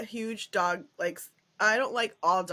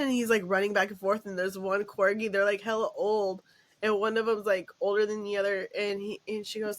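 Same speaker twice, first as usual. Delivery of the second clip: ordinary talk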